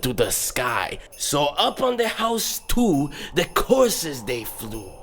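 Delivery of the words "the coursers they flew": plain reading voice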